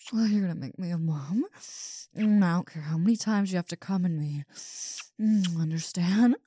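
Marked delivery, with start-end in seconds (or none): none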